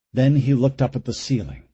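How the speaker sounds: usual speaking voice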